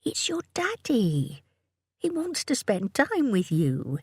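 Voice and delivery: tender murmur. A narrator's reading. Mother reassuring clinging toddler, whispery murmur. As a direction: gently reassure a frightened little one. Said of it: "murmured Jo".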